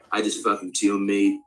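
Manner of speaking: usual speaking voice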